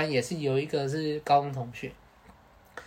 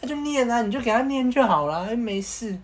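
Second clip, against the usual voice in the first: higher, airy brush-off of a friend's worry; carefree teasing; 7 semitones higher than usual. Retold or imitated. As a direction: laugh off his fears. (轻松打趣)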